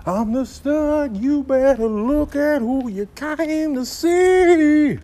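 slow, loud, high swaggering brag; boastful strut. Acted. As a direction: show off like the stud of the street. Strutting cat boasts slowly.

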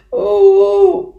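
loud, high "oh-oh" sob; high sobbing wail. Acted. Lonely little beaver crying by the lake, high sobbing. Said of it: "snikte hij".

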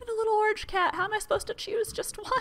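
very high delighted voice; squealing delight. Real, not acted. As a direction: gush helplessly over too many pets. Overwhelmed by cute pets.

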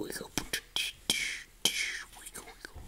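playful tiny whisper; whispered singsong. Real, not acted. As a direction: breathe the words out silently. Hiding from monster in game.

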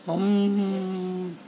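long, even humming drone; bee hum. Acted. Honeybee returning to its story of the comb, humming. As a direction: hold one level buzzing hum.

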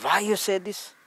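cross father's question, acted in a retelling; retold angry father. Father scolding his little daughter after the visitor left, angry. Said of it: "very angry".

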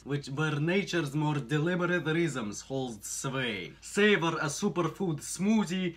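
put-on Russian accent, building towards the end. Reading the blurb on partner's request, Russian accent. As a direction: read it like a Russian, drawing out the last phrase. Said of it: "with a nice Russian accent".

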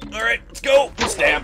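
high, loud shrieking, climbing; hammed-up crazed attacker; manic charge. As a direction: squeal each stab with glee. Charging in to stab in game.

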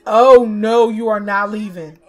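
sudden loud no, then firm; loud at the screen; loud protest. Real, not acted. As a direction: stop them from leaving. Players leaving the party.

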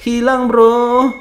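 very slow, stretched-out repeat; slow drawn-out loud lament. Real, not acted. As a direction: drag each word out in disbelief. Repeats it slowly.

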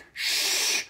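soft shushing sound; quiet shh; playful shush. Acted. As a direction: hush them like a secret. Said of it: "hushing after "on".

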